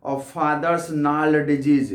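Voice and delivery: each word read out slowly and clearly; slow poem reading. Shown as a demonstration. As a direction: read the line slowly, as for a class. Teacher reads poem line aloud. (धीमे-धीमे कविता पढ़ना)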